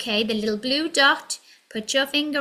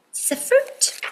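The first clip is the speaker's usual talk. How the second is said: high aside; 13 semitones higher than usual. Acted.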